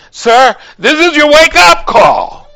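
firm nurse spelling it out; nurse's warning. Retold or imitated. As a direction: lean in and warn him slowly. Nurse voiced in retold story.

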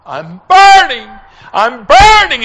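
high, loud agonized cry; retold yell, falling at the end. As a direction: cry out as if burning. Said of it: "he started yelling it".

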